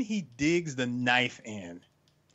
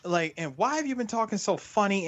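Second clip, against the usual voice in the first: high, mock-scolding girlfriend; angry girlfriend; 7 semitones higher than usual. Friend voicing the girlfriend confronting him, high scolding. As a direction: fire questions like a fed-up girlfriend. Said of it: "she was like".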